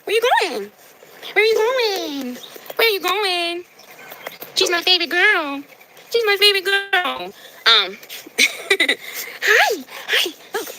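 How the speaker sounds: high, drawn-out cooing pet voice. Real, not acted. Woman cooing at her cat during a livestream.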